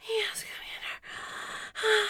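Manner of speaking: slow breathy whisper. Acted. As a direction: breathe it out slowly.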